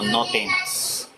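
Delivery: sweet gentle voice. Retold or imitated. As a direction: say it very slowly and gently, like a calming presence. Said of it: "una voz tan juvenil, tan dulce". (voz dulce y serena, muy lenta)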